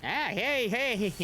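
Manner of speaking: surprised yelp. Real, not acted. Streamer gets hit in game.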